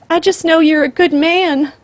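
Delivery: high, loud desperate plea; cling to the last hope. A narrator's reading. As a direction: grandmother begging the killer, desperate. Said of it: "she said desperately".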